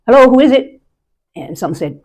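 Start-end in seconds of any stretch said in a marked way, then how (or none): 0.1-0.8 high, loud call through the door; loud, raised call to the door. Retold or imitated. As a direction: call to whoever is knocking. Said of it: "most of the time I'd say".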